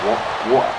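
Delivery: "Water?" with a glottal t, asked back; "What? Water?" puzzled, t swallowed; mock British water. Mimicking how everyone says it: "What? Water?". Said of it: "but it's like".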